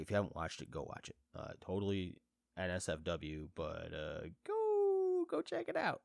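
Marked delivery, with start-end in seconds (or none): 4.5-6.0 very high, drawn-out nudge; playful urging. Real, not acted. Host nudging viewers toward a favourite movie, playful urging. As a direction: stretch it out in a squeaky high voice.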